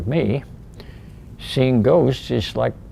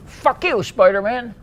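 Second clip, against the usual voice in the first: defiant sneer; 11 semitones higher, 3 dB louder than usual. Retold or imitated. Mocking quote of the ghost.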